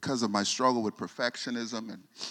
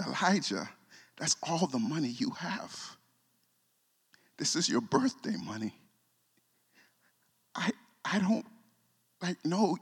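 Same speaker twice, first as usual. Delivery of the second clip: higher, choked-up disbelief; pleading no, you can't give me this; touched protest; 6 semitones higher than usual. Retold or imitated. Father protesting to his son, higher and overwhelmed. Refusing son's birthday money.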